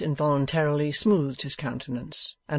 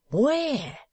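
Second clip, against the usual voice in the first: sharply higher Where; eager question; 11 semitones higher than usual. A narrator's reading. Venus pressing Wegg for the location, eager. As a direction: jump in eagerly.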